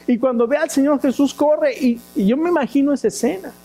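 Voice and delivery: rising fervor. Real, not acted. Preacher building to climax.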